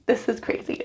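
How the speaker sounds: hushed, nervous aside to herself; nervous whisper. Real, not acted. About to shave her head, whispering to herself. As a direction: whisper it under your breath.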